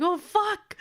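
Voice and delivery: startled curse. Retold or imitated. Kaoru freaks out caught.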